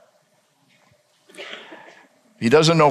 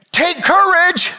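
high, slow call across the storm; Jesus calling out; 12 semitones higher, slower than usual. Acted. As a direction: call out to steady them. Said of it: "Jesus says".

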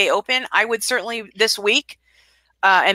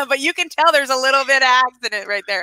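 normal talking voice

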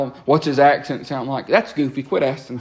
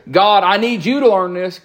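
a touch of a bossy demand, slightly louder; pushy demand; 6 semitones higher, 4 dB louder than usual. Retold or imitated. Preacher mimicking a prayer that tells God, mild pushy demand. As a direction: lecture God lightly. Wrong prayer set against right.

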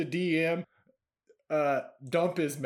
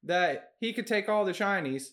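sheepish goblin; 6 semitones higher than usual. Acted.

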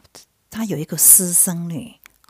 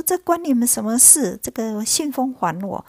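higher, louder retort, mildly angry; acted indignation; 6 semitones higher, 4 dB louder than usual. Mocked worker demanding her letter back, acted indignation. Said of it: "就很气".

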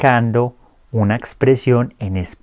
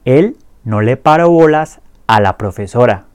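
clear repeat-after-me drill. Shown as a demonstration. Teacher drills the conjugations for students to repeat, clear and steady. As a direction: say each line clearly for learners to echo. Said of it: "Repitan después de mí".

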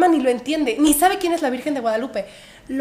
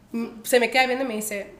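normal talking voice

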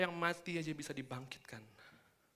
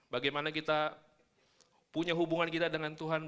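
ordinary talk

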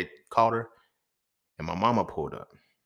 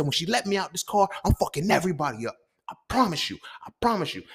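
high-pitched, heated vow; retold earnest threat; 9 semitones higher than usual. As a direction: swear revenge, worked up and meaning it. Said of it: "I meant that shit".

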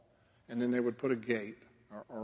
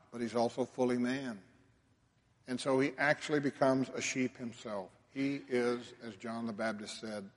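normal talking voice

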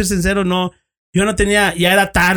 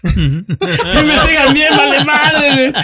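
high, laughing complaint, louder; cracking up at the irony; 9 semitones higher, 4 dB louder than usual. Real, not acted. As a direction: laugh through the words.

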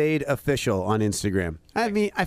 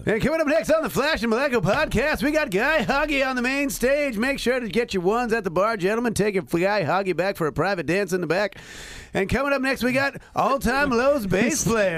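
high, hyped strip club MC; 5 semitones higher than usual. Acted. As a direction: hype it up like a club emcee. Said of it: "I'll do it in the strip club voice".

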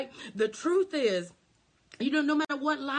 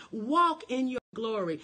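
usual speaking voice

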